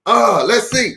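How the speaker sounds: ordinary delivery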